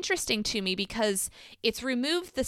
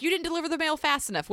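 higher, fast mock complaint; mock complainer; 8 semitones higher, faster than usual. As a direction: toss off the accusation in a put-on high voice.

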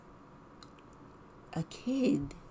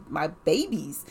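usual speaking voice

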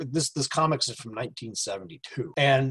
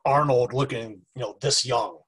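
normal talking voice

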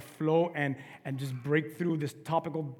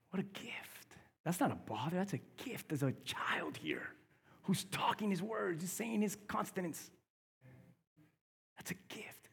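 hushed awe; 3 semitones higher, 8 dB quieter than usual. Acted. Baby talking during sermon.

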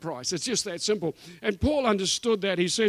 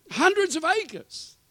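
amazed; 11 semitones higher, slower than usual. Real, not acted. Marvelling at the hand-ploughed farm.

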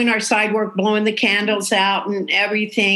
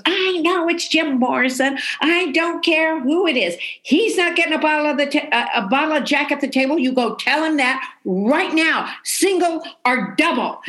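raised pitch, rapid-fire mock tirade; imitating exasperated bartender; 6 semitones higher than usual. Bartender orders the waitress back to Morrison, acted exasperation. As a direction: lay down the law, fed up.

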